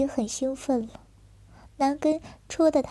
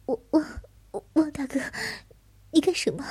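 panicked; 4 semitones higher, faster than usual. Acted. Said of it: "惊慌意乱地".

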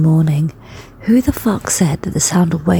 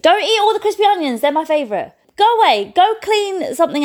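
playful scolding; 10 semitones higher than usual. Real, not acted. Scolding the dog in the kitchen.

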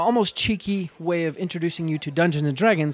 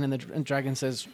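ordinary talk

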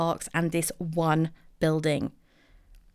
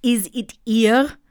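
slow, louder, hammed-up French accent with halting uhs; awful fake French accent; 4 dB louder, slower than usual. Herself in the dream asking for the fuel tanker, in a hammed-up fake French accent. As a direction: ham up a terrible French accent, dragging out every word. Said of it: "I put on an awful fake French accent".

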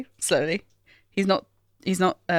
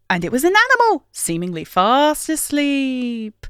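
drawn-out storybook wonder, higher; 5 semitones higher, slower than usual. Real, not acted. Co-host reading badger thread aloud, like a children's story. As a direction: read it like a bedtime tale, stretching the words. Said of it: "reading a kid's story".

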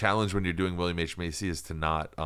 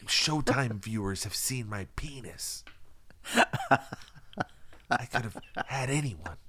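slow, hushed mock lament; whispered Macy impression; 4 semitones higher, slower than usual. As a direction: breathe it out slowly like a sulking star. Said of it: "I'm making him whisper".

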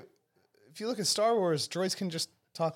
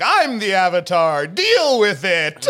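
loud, slow, defiant shout; Korra shouting; 6 semitones higher, 10 dB louder, slower than usual. Retold or imitated. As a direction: bellow it like a proud hero. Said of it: "was like".

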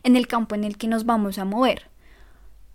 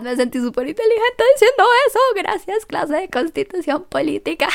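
mock smug; 9 semitones higher, 5 dB louder than usual. Mock pride after political fact.

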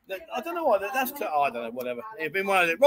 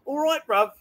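higher mock "bruv" accent; non-Australian accent; 6 semitones higher than usual. Host mimics the learner's mistaken Australian, mock "bruv" accent. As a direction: echo her wrong idea of an Australian accent. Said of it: "No, that's not Australian".

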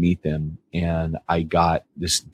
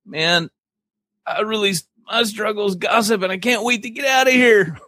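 relieved pastor. Acted. Joke punchline, relieved pastor.

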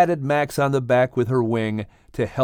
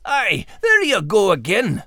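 higher voice with a brogue; dog character voice; 6 semitones higher than usual. Acted. Dog recalling his scare, higher voice. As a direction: play the dog, still shaken.

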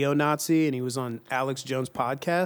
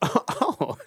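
laughing; 4 semitones higher, 4 dB quieter than usual. Real, not acted. Laughing at bad pun.

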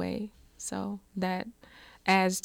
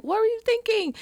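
high, loud mock scream at the other driver; retold furious yell; 4 dB louder than usual. Mother yelling at the other driver after the crash, furious high shout. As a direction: shriek it like you're livid again after the crash. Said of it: "I'm screaming at the driver".

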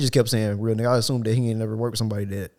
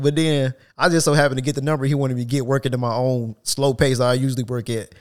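usual speaking voice

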